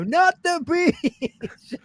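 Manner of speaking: high, slow, drawn-out anguished wail; mock Nicolas Cage wail. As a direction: stretch it out like a hammy Cage. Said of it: "stand up in the middle of it and go".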